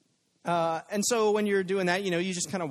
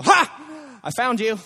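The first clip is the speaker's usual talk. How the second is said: sudden high loud "Ha!" like springing a trap; mock gotcha shout; 8 semitones higher, 9 dB louder, slower than usual. God imagined springing out on Adam, loud gotcha. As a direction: jump out and catch them. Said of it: "sneak up behind them and go".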